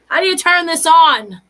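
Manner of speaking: put-on a small boy puzzled by a toy; little boy. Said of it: "he's like".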